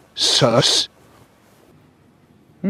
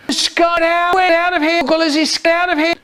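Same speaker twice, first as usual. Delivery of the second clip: high loud panicked scream; 12 semitones higher, 3 dB louder than usual. Acted. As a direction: shriek the words in panic. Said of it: "he screams".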